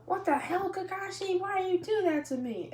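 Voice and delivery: put-on boy startled by teacher behind him, high outburst. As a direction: jump out of your skin at him. Kakashi startles Naruto from behind.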